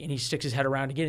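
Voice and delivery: normal talking voice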